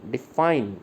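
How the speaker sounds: Define with the weight on FINE; short di, strong fine in define; stress on F. Shown as a demonstration. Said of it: "इसमें हम F पे stress देते हैं".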